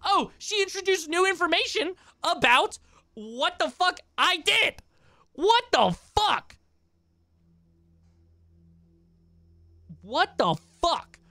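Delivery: rising disbelief at every word; incredulous. Real, not acted. Reacting to the apology, high and slow in disbelief.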